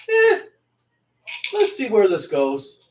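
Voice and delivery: high little "eh", wait-and-see; noncommittal mood. Acted. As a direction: voice a shrugging, undecided reaction. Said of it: "kind of mood".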